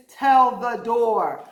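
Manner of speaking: slow, loud call to the room. Shown as a demonstration. Teacher calls to the class to shout the word. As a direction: tell the ceiling, loud and slow. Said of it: "call to the class".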